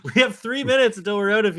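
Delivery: hammed-up craving for a trade; mock longing. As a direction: whine like a desperate fan. Continues mock plea.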